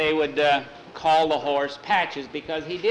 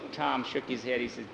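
ordinary delivery

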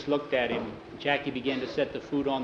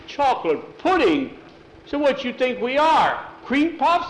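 much higher, louder, disbelieving shout; outraged scoff; 11 semitones higher, 6 dB louder than usual. Retold or imitated. Miguel scoffing at the boy's pudding, outraged shout. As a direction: scoff at it like a grown man insulted.

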